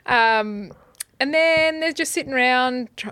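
ordinary talk

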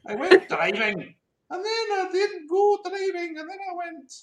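high, slow put-on German accent; mock German accent. Podcaster jokes in a fake German accent, high and slow. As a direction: ham up a wobbly German accent. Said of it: "really weird accent".

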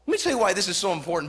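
ordinary delivery

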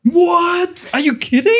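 high squealing what; shrieking in disbelief; surprised. Pretend. Mock shock at theory.

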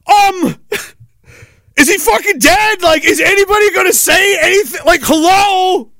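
a buddy shouts at the crowd ignoring an injured man; incredulous shout. Retold or imitated. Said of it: "my buddy Plant, he's like".

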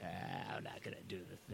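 very low, whispery mumble; muttered aside. Acted. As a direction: whisper it to yourself, deep and hushed. Clown talks aside to himself.

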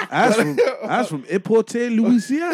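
put-on high, laughing country accent; mock Louisiana accent. Mocking her Louisiana accent.

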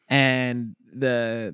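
ordinary talk

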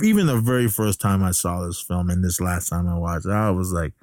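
ordinary talk

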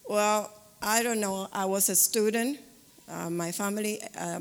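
usual speaking voice

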